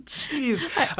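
high burst of laughter; laughing. Real, not acted. Laughing after silly line.

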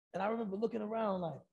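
normal talking voice

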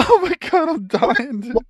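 breaking up with laughter; can't stop laughing; laughing hard. Real, not acted. Laughing at the grass bike story.

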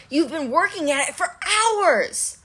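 high, drawn-out complaint; exasperated. A narrator's reading. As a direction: stretch the words in fed-up frustration.

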